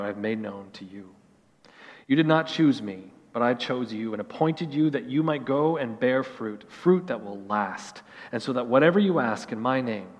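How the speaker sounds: measured, solemn Bible reading with soft phrase endings; scripture reading. Acted. Pastor reading scripture to the congregation, solemn and measured. Reads John 15 before sermon.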